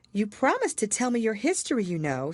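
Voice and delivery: a hint of young Alice, curious. A narrator's reading. Alice's voice in story.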